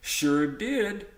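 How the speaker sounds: teacher voicing the two men replying to Sam; Dan and Bob answering. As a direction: answer back brightly. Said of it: "Dan and Bob said".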